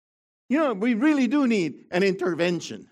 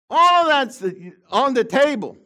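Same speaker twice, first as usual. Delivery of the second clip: much higher and louder, thrown out; loud preaching cry; 8 semitones higher, 9 dB louder than usual. Real, not acted. Pastor crying out to his congregation, loud. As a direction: proclaim it to the back row.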